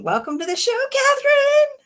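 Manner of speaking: high, loud rising cheer; excited welcome. Real, not acted. Host welcoming guest to the show, excited.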